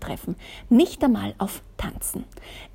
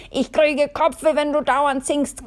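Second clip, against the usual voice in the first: slow, loud, higher nagging snap; grumpy Miss Kommando nags Miss Glitzer about her singing, louder and higher; shrill scolding; 5 semitones higher, 5 dB louder than usual. Acted. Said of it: "keifte Miss Kommando".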